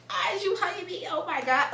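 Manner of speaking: high put-on "why is you hugging me"; playing her own baffled reaction to the hug; mock bewildered self. Reacting to her friend's hug, mock bewildered and high. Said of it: "I'm like".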